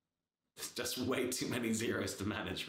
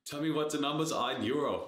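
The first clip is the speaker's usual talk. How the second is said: put-on loud, higher German accent demanding numbers; better German accent; 5 semitones higher, 7 dB louder than usual. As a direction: play the angry German CFO. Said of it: "That was better".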